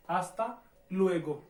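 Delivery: slow आस्ता लुएगो, no h sound; slow model of hasta luego. Shown as a demonstration. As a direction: say आस्ता लुएगो slowly, clearly. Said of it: "है silent है".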